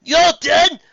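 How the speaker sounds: very high, loud hail; loud call. Acted. Final hails the others loudly from the Kraken.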